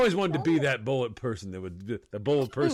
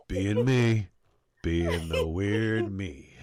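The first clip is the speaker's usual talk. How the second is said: deadpan reply; 6 semitones lower, much slower than usual. Acted. Deadpan answer to the alarmed victim.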